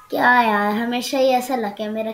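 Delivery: loud burst of frustration; loud at the start, fed up; exasperated outburst. Real, not acted. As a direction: complain loudly about rotten luck. Loud complaint about his luck.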